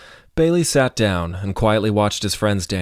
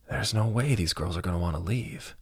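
sighed reply; 8 dB quieter than usual. A narrator's reading. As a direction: say it softly, as if sighing. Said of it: "Joey sighed".

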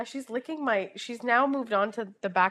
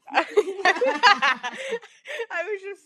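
laughing; 9 semitones higher, 4 dB louder than usual. Real, not acted. Laughing at own story.

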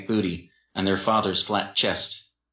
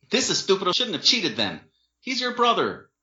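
exasperated; 11 semitones higher than usual. Acted. Dean arguing with Jen.

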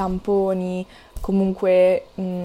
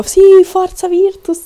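high, loud, slow team cheer; excited cheer; 9 semitones higher, 8 dB louder than usual. Retold or imitated. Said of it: "io dicevo".

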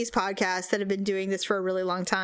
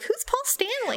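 much higher, dopey question; mock clueless friend; 13 semitones higher than usual. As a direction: tease her for not knowing.

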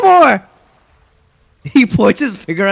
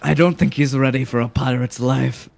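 much lower, soft; low gruff voice; 12 semitones lower, 6 dB quieter than usual. Acted. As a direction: rumble it low.

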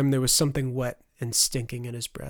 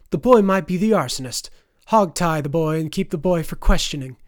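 loud, high-pitched imperious order; commanding noblewoman; 4 semitones higher, 9 dB louder than usual. A narrator's reading. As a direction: order the constable about, voice raised. Noblewoman ordering a constable to act.

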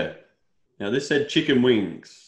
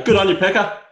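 sarcastic cheer; 8 semitones higher, 7 dB louder than usual. Real, not acted. Sarcastic praise of rich friend.

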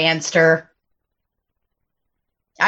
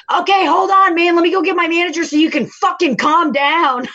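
high, heated telling-off, reenacted; retold scream; 8 semitones higher than usual. Server retelling screaming at Jerry through the mask, raised angry voice. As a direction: snap at a customer as you retell it. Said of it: "I screamed at him".

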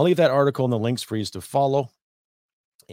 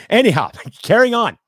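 higher and louder, laughing through the words; 10 semitones higher, 10 dB louder than usual. Real, not acted. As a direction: chuckle your way back to the topic. Laughs off his own joke.

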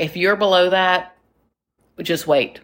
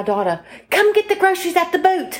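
high, imitated English accent, rising into a yell; Michelle's English accent; 13 semitones higher than usual. Retelling a pool friend's yell to her daughter, mock English accent. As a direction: mimic an English mum calling her daughter. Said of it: "I'm talking to Michelle from England, and she said".

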